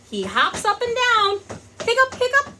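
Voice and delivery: high, loud, bouncy story read; lively storytelling. Acted. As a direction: bounce along with the hops. Narrating with mock hiccups.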